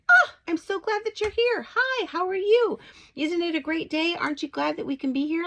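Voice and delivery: high, loud, bubbly welcome to strangers; joyful greeting. Retold or imitated. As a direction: overflow with joy as you greet them.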